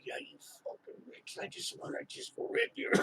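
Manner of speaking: breathy hushed whisper of inner spite; secret whisper. Retold or imitated. Telling the class what he secretly thought of her, in a whisper. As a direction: whisper it like a thought you'd never say aloud.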